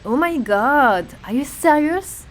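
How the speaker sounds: high, slow, stunned disbelief; shocked. Acted. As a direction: gasp it out in disbelief. Shocked reaction.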